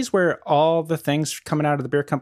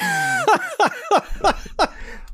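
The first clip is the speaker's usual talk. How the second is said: high burst of laughter; real laugh at the joke. Real, not acted. Laughing at brewery website.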